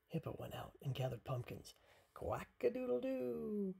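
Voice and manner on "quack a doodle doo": high, drawn-out rooster-style quack; high crowing storybook duck. A narrator's reading. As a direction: crow it out like a duck playing rooster. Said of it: "cried Duck".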